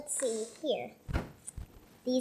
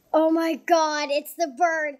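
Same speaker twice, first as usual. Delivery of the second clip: ordinary delivery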